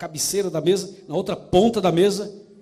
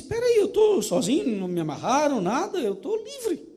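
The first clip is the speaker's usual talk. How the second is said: much higher, excited disbelief as it dawns; amazed realization; 8 semitones higher than usual. Acted. Freed slave alone at table realizes he's free, high amazed. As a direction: voice jumps up with sudden joy.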